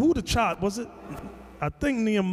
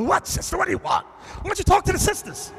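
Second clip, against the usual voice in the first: high, impatient brush-off; pitched-up exasperated what-do-you-want; 10 semitones higher than usual. Retold or imitated. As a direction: wave her off to the sisters, exasperated. Preacher acts out brushing her off.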